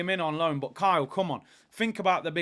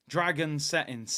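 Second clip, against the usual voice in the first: ordinary delivery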